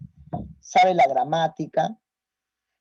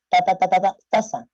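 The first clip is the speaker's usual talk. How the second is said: repeating ta, ta, ta before taza; stuttering demo; 4 dB louder than usual. Shown as a demonstration. Teacher showing a clonic stutter on taza. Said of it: "repetitivas de sílabas iniciales".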